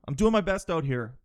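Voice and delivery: ordinary delivery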